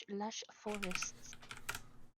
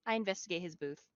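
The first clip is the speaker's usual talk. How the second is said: ordinary talk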